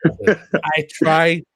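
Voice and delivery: put-on loud, high-pitched Irish accent. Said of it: "Terrible Irish accent".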